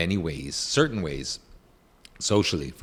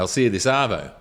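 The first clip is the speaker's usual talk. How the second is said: lightly put-on Aussie accent; a hint of Australian twang on "arvo"; Australian slang accent; 2 semitones higher, 3 dB louder, faster than usual. Demonstrating Australian slang to the hosts, light Australian accent. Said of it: "they talk almost in code".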